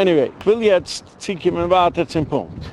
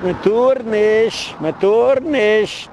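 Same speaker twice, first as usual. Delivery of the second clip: higher, long drawn-out mock cry; imitated cry; 5 semitones higher, much slower than usual. Woman caught with a man, crying out in mock protest. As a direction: call it out slowly, every word stretched high. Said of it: "und sie schreit".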